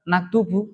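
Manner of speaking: "Naktubu" ending in an open u; correct u ending. Shown as a demonstration. Teacher gives students the correct reading, u ending. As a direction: finish "Naktubu" on -bu. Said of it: "Nahnu apa".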